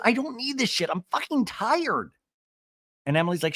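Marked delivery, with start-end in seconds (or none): 0.1-2.1 hammed-up weary outrage; mock exasperation. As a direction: act out a fed-up meltdown. Acting out Shannon's exasperated outburst.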